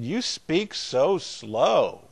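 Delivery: drawn out at half speed; slow, lingering imitation; mock slow speech. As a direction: stretch each word out slowly, imitating a remark. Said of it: "they all thought I was from Kentucky".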